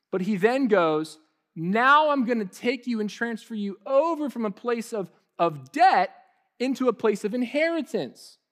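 impassioned preacher building to a climax; full-force preaching. Real, not acted. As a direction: preach it with rising passion.

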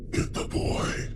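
breathy raspy whisper. Retold or imitated. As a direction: rasp it out like a ghost. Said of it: "In a very raspy, wispy voice".

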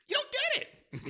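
very high, slow squeaky put-on voice; mock pitchman. Mocking a product pitch in a silly high squeak. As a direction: stretch it out in a silly high cartoon voice.

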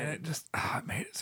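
soft breathy whisper, moved; real hushed awe; awed whisper. Real, not acted. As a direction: whisper it, overcome. Overcome by the film's ending.

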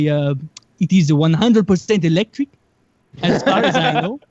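mock Nigerian accent, hesitant start; Nigerian pilot impression. Pilot answering the reporter about his machine, Nigerian accent. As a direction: imitate an earnest amateur pilot, Nigerian-accented. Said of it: "the guy himself doesn't even talk much".

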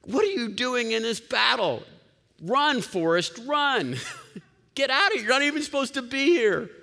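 high, loud drill-sergeant yelling. Retold or imitated. Pastor playing Sergeant Jesus ordering a soldier out, loud hammed-up yell. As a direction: bark it like a sergeant chasing a recruit off the field. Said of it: "Sergeant Jesus says".

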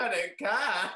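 high, loud, giddy brush-off. Retold or imitated. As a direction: toss it off, thrilled. Retold eager reply, laughing.

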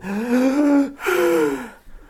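put-on sharp, high sobbing gasp of a child; imitated child gasp. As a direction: mimic a little girl catching her breath. Child gasping after tantrum.

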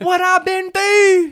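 loud, high, slow mock-Baltimore rap; Baltimore rapper impression. Imitating a kid auditioning with his verse, exaggerated Baltimore accent. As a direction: exaggerate the Baltimore vowel in "through". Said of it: "the dude was like".

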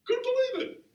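high, squeaky cry of disbelief; mock disbelief. Preacher recalling his youth-night outfit, high mock disbelief. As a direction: pitch it way up in fake shock. Repeated, much higher second time.

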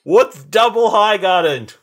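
high, drawn-out comic shout; mock triumphant yell. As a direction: holler it out slowly from a distance, in jest. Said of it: "And he yells out".

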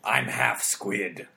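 very low, slow, harsh voice; gruff squid man. Acted. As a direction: drag it out low like a sea monster. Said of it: "glottalization caused by your tentacles".

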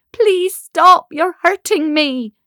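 high, loud pleading; crying in pain. Acted. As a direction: beg for it to stop, hurting. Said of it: "she cried in pain".

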